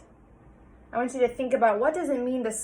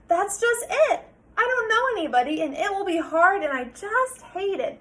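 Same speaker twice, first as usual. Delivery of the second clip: much higher, louder, rushed complaint; upset child; 8 semitones higher, 6 dB louder than usual. Acted. Sarah explaining her fear to her father, high and rushed. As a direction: pour out the worry.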